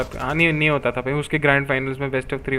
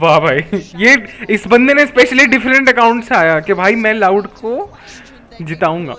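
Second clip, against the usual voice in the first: excited mocking; 6 semitones higher, 5 dB louder, slower than usual. Real, not acted. Mocking a poll voter.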